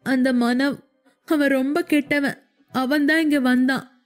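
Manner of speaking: high, broken, sobbing words; crying. A narrator's reading. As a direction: cry through each halting phrase. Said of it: "அழுது கொண்டே". (அழுதுகொண்டே உடைந்த குரல்)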